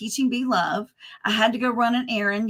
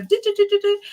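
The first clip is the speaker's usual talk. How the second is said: quick high mock do-do-do, much higher; zippy imitation of rushing; 10 semitones higher, 3 dB louder, faster than usual. Speaker mimics fast walkers at the airport, playful high do-do-do. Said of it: "I'm not like".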